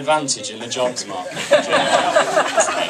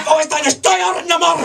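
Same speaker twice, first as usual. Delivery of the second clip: hammed-up furious, high shouting; Bruno Ganz; 6 dB louder than usual. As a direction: rage like an enraged tax official. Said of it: "getting angrier and angrier and angrier".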